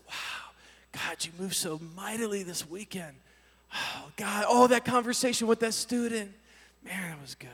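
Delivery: soft, dreamy murmur to oneself; zoned-out musing. Retold or imitated. Driver alone after a powerful retreat night, zoned-out musing. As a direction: muse quietly, lost in thought. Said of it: "I'm kinda zoning out".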